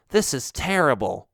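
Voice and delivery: complaining. Acted. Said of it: "one of the bats complained".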